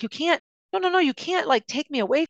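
high, pleading protest; rising, desperate no, no. Retold or imitated. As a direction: beg him not to cut you off. Pleading as he cuts her off.

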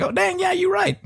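high, sudden surprised squeak; like you just spotted it; surprised realization. Retold or imitated.

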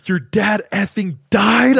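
high, loud, stretched-out shock; incredulous high outburst; jaw-dropped disbelief. Retold or imitated. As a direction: exclaim it slowly, stunned by the news. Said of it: "my jaw dropped".

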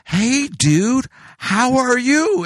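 high, loud, drawn-out patronizing greeting; over-careful, stretched-out friendliness; exaggerated slow talking. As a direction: imitate someone talking to the slow-witted. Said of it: "trying to talk to me slower".

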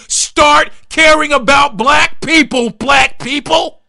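high, loud and slow, hammering each word; rally the listeners at the top of the voice; fired-up shout. Real, not acted. Impassioned call to action.

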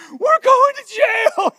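high, slow, panicked shout; freaking out. Retold or imitated. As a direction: wail it like a terrified teenager. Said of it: "I was freaking out".

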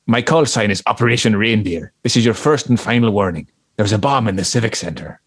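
mock Irish hoax caller; amateur Southern Irish, a bit Terry Wogan; fake Southern Irish accent. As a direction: read the warning in a put-on Southern Irish accent. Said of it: "put on an Irish accent".

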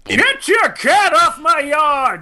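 slow, hammed-up yell of a grumpy neighbor. Slow mock yell as the grumpy neighbor. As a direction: drag it out like an angry old man. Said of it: "It's A-hole insert name".

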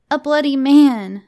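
put-on high, loud, slow child voice; emphatic little girl. Miranda answers Mummy's question, high and emphatic. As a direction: announce it emphatically as a small child.

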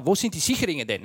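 low gruff German mechanic; German accent. Retold or imitated. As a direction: ask in a deep German accent.

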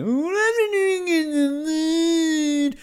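high, slow fake-crying whine; whingy celebrity. Mocking a contestant as a whingy celebrity, high fake cry. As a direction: fake sob it like a spoiled celebrity. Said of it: "sound like a whingy celebrity".

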